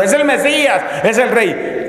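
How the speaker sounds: high, mock crowd cry; crowd shouting. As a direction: call out like people still acclaiming. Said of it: "la gente todavía gritando".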